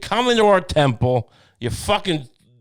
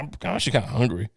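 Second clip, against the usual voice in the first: offhand mumble; 4 semitones lower, 5 dB quieter, much faster than usual. Retold or imitated. Jesus in garden, casual.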